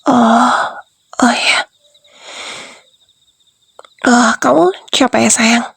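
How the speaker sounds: a hushed aside as it dawns on you. Acted. Gently realising, whispering to partner. (bisikan lembut)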